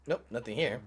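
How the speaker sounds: usual speaking voice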